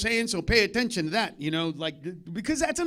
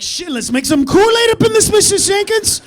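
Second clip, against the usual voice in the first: loud, high, eager request; excited kid; 11 semitones higher, 13 dB louder than usual. Retold or imitated. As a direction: pitch it up and push it out like a thrilled kid. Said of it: "And I was like".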